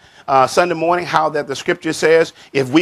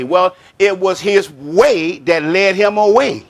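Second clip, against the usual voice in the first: normal talking voice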